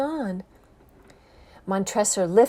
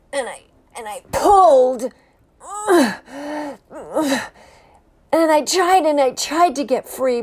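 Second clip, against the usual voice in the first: louder, higher, pulling hard; straining effort; 5 semitones higher, 8 dB louder than usual. Acted. Chained prisoner struggles to break free, straining loud. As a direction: push the words out while heaving.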